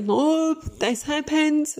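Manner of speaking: mock kid voice, high and drawn out; whiny kid insisting. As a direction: insist like a pleading little kid. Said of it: "It's like".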